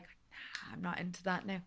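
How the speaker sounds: very quiet retold brush-off; dismissive murmur. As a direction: wave it away under your breath.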